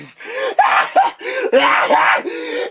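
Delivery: very high, shrieking manic laugh; long crazed cackle at full volume; screamed laugh verging on crying; hysterical laughter. Acted. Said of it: "laughing hysterically".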